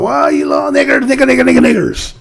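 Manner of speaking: quick mocking imitation of a teacher chanting the slur; imitating the teacher. Mimicking a Wisconsin teacher at her class, quick and mocking. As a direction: rattle it off fast like the teacher. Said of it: "She said it real quick".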